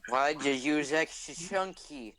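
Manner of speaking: slow, drawn-out character voice; put-on quoting voice. Quoting a cartoon line about soup, put-on voice. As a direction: quote it slowly in a silly different voice. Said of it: "a quote in a different voice".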